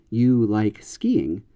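slow statement, skiing falls at the end; plain statement. Shown as a demonstration. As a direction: let skiing drop as a flat statement. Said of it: "If the declarative form".

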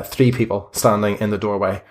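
ordinary talk

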